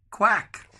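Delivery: high, loud quack; duck quack. A narrator's reading. Grandad reading to grandchildren, a high loud duck quack. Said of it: "The duck answered".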